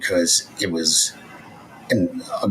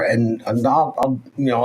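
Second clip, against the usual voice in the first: ordinary talk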